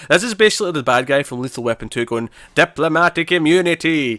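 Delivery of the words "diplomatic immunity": slow, hammed-up South African accent; bad South African accent impression. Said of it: "my atrocious Southern African accent".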